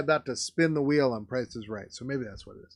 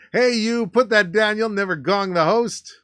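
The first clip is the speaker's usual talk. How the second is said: loud, raised-pitch warning; calling out sternly; 7 semitones higher, 8 dB louder than usual. Acted. Reader acting out a card telling someone to put that down, hollered. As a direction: holler it at someone across the stage. Reads card as angry outburst.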